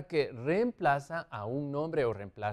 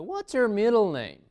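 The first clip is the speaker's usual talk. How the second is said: higher, louder, words run together; teacher's livelier repeat, less drawn out; connected model question; 6 semitones higher, 5 dB louder than usual. Shown as a demonstration. Teacher repeats question more fluently.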